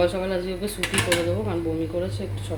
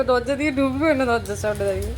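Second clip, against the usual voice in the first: ordinary delivery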